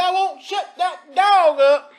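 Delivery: slow, loud shout at the dog. Real, not acted. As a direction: yell it across the room to shut the dog up. Yelling at barking dog.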